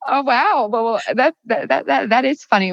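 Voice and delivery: stumbling over words in happy astonishment; amused surprise. Real, not acted. Guest reacts to the coincidence with the host, amused surprise.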